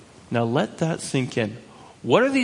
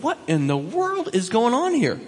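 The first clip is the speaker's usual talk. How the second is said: high-pitched, astonished question; bewildered; 7 semitones higher than usual. Acted. Shepherds reacting to the angels' news, bewildered. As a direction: act out baffled disbelief with raised pitch.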